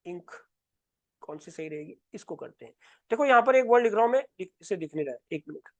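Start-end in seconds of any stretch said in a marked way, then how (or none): 0.1-2.7 very quiet, low muttering to oneself; barely audible self-talk. Real, not acted. Talking to self while choosing ink. 4.4-5.7 low, hushed aside while busy; very soft, low self-talk. Real, not acted. Fumbling with writing, talking to self.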